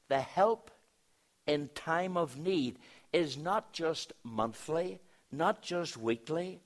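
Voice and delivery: high, loud, driving preacher's voice; emphatic preaching. Real, not acted. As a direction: hammer each step, building to a peak. Preaching builds to full force.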